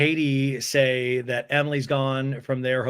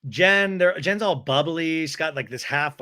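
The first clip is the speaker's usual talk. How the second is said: ordinary delivery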